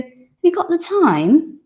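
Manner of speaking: casual native question. Retold or imitated. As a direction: toss it off like a local. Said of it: "And they go".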